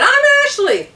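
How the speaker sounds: high, bright lightly voiced stranger; a stranger introducing herself happily; cheery introduction. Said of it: "And she said".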